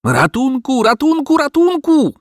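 very high, sustained screams for help; bawling. Acted. Dog under window to his master, bawling. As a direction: bawl for help in one unbroken run. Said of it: "wydzierał się pies".

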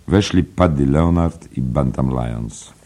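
ordinary delivery, nothing marked

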